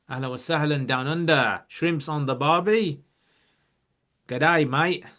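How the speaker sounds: broad, loud Aussie greeting with stretched vowels; Australian accent. Acted. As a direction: put on a playful, high-swinging Australian twang. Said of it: "My Australian mode is kicking in".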